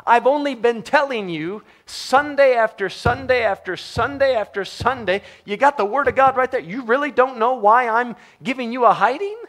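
high, loud exasperation; exasperated God. Retold or imitated. God scolding the confused sinner, high and exasperated. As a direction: scold like a fed-up parent. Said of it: "And God says".

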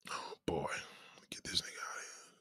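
breathy whispered aside. Real, not acted. Whispering to the moderators to remove a troll. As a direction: whisper it to the side, under your breath.

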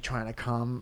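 usual speaking voice

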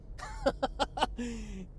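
soft, rapid run of ha-has; chuckling laugh. Real, not acted. Podcaster after teasing tie-your-shoe advice, soft chuckling laugh. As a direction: chuckle on and on, quite softly.

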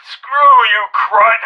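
loud, curt dismissal; irate officer's parting shot; angry insult. Acted. Colonel's angry follow-up message.

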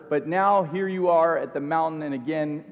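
normal talking voice

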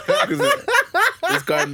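high laughter breaking through words; laughing. Real, not acted. Laughing fit.

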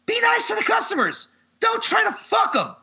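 high-pitched, exasperated plea; exasperated rant. Real, not acted. Ranting at mechanics about treating customers, high and exasperated. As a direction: squeal the advice in sheer exasperation.

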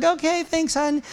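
small, high, softened voice; mock meek reply. Climber dad answering his daughter, mock meek. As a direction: play the humbled dad, high and quiet.

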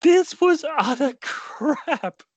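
high, slow mock outrage; disgusted. Real, not acted. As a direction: drag it out in disbelief.

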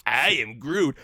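sassy Groot, last word popped high and loud; Groot with attitude. Retold or imitated. Imitating teen Groot in the opening scene, with sass. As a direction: give the Groot line some sass. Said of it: "with the attitude".

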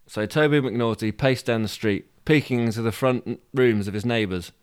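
ordinary delivery, nothing marked

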